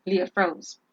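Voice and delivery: plain reading voice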